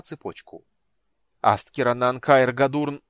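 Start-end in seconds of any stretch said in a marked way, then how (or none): 1.4-3.0 loud slow chanted spell; spell incantation. A narrator's reading. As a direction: intone the spell forcefully.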